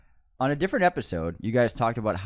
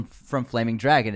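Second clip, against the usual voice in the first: ordinary talk, nothing marked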